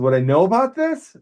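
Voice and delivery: baffled that he knew it; amazed question. Retold or imitated.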